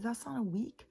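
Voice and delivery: high, insistent protest that it's not a week; arguing with her daughter over the days, up high and insistent; indignant insistence. Retold or imitated. Recalling the fight with her daughter, pitched high in protest.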